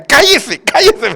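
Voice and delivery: high, loud, laughing shush; mock her telling the robber to hush through giggles. Sister shushing a robber while laughing, high and loud. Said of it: "y decía".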